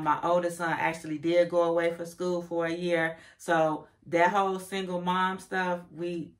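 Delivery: ordinary talk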